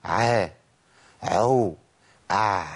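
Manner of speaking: "ع، عو" with breath leaking out; faded ع sliding towards ح, airy; breathy wrong ع. Shown as a demonstration. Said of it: "سامعين النفس؟ النفس طلع".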